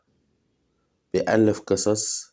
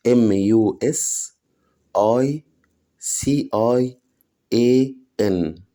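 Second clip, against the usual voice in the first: slow spelling; much slower than usual. Shown as a demonstration. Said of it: "هقولها تاني".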